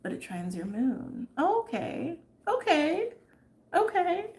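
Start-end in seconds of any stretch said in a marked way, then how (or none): 1.4-4.3 high surprised okay. Real, not acted. Realizes correction.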